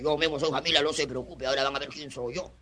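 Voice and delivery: low, quiet menacing figure; tough ex-convict. Retold or imitated. Ex-convict promising the professor he'll handle the class, low quiet menace. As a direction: drop low and soft, sure of yourself. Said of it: "El negro agarra y dice".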